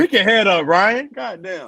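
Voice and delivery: high, loud mock coach; coach yelling. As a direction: bark at Ryan like a coach.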